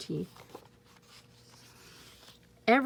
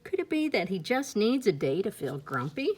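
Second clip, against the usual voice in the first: plain reading voice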